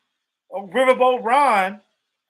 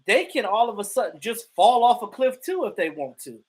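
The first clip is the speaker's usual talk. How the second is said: usual speaking voice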